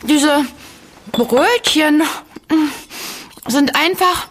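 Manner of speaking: talking with a mouth full; words round a bite of food. Real, not acted. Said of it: "sprichst du etwa gerade mit vollem Mund?".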